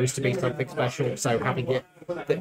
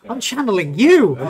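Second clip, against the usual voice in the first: laughing; 9 semitones higher, 8 dB louder than usual. Real, not acted. Laughs after biting tongue.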